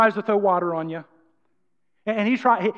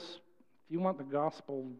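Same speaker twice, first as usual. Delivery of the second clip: mock a meek, apathetic pitch; unenthusiastic demo; 8 semitones lower, 15 dB quieter than usual. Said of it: "we can't go into the world with this".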